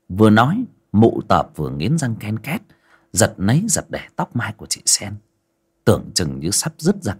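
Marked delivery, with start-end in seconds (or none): none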